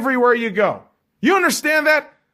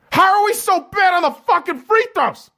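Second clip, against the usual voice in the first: exasperated outburst; 11 semitones higher than usual. Real, not acted. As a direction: throw up your hands at the free throws. Exasperated rant about Lakers free throws.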